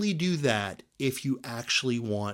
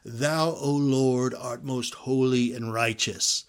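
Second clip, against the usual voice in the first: King James prayer voice. Retold or imitated. Preacher mocking churchgoers' put-on King James prayer, slow and solemn. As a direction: intone it like a pompous Shakespearean prayer. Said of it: "Prayer voices".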